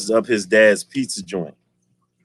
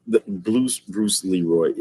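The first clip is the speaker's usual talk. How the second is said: ordinary talk, nothing marked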